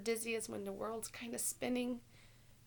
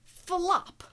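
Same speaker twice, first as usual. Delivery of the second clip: flop; 7 semitones higher, 12 dB louder than usual. Acted. Penguin falls.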